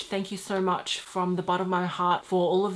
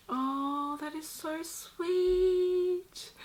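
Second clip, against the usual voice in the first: drawn-out, much higher, very slow coo; touched coo; 9 semitones higher, much slower than usual. Real, not acted. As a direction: melt over the card, stretching every word. Reading the sweet card.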